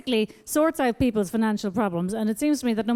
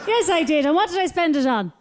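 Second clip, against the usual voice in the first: put-on high sing-song baby talk; 6 semitones higher than usual. Baby voice continues.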